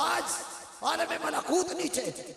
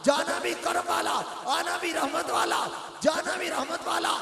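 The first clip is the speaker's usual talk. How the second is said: loud, ringing sermon delivery to the hall; full-force preaching; 3 semitones higher, 5 dB louder than usual. Acted. Preacher rousing the gathered congregation, full-force preaching. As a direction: preach it out to the crowd with fervour.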